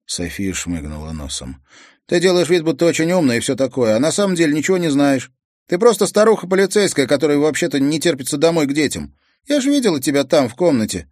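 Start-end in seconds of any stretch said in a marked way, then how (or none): 2.1-11.1 fast, loud and much higher, spitting it out; angry outburst. Acted. Teenage girl lashes out at the policewoman, furious and fast. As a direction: lash out at the officer, words tumbling fast. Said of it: "В голосе звучала злость".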